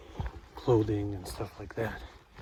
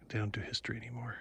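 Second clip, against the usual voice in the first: whispering; 8 dB quieter, much faster than usual. Real, not acted. Snarky aside whispered.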